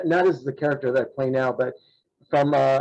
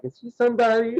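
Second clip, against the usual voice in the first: high-pitched curious bystander; puzzled onlookers; 8 semitones higher, faster than usual. Retold or imitated. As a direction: wonder aloud in a raised, puzzled voice. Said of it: "they're all looking at me like".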